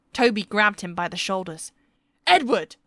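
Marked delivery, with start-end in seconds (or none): 2.3-2.8 loud, sharp call of the name; shout. Acted. Toby shouting at Edward over the blast, loud and angry. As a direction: grab his attention with a shout. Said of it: "he shouted".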